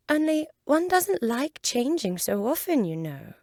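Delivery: put-on girl fretting about changing; a high girl's voice; young Alice.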